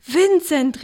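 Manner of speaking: high, loud happy call of the name; joyful call. Acted. Father greets returning son Vincent with joy. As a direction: greet your son with delight. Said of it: "rief er freudig".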